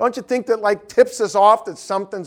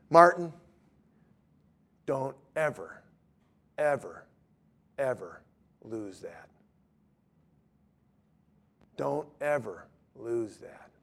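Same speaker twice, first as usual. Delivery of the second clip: low, hushed, with long pauses; quiet, heartfelt retold counsel; solemn plea; 6 semitones lower, 7 dB quieter than usual. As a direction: urge him gently, letting each word sink in. Earnest plea to weeping student, retold.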